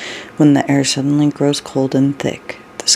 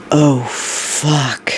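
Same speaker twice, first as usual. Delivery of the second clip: drawn-out, hushed dawning dread; stunned, stretched-out swear; sudden realization. A narrator's reading. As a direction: let the curse sink out slowly as it hits you. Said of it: "he says suddenly".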